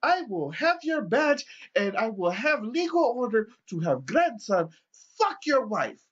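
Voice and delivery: high, loud offended grandpa; the old man challenging the cop, high and loud; indignant old man. Retold or imitated. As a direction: sound scandalised and high. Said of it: "he's just like".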